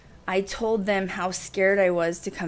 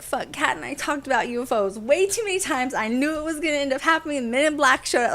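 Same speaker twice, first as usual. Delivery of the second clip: higher, louder, rushed panic; panicked outburst; 6 semitones higher, 4 dB louder than usual. Retold or imitated. Seeing aliens at her bedside, a panicked high loud outburst. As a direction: blurt it out in a rush of panic. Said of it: "I was panicking".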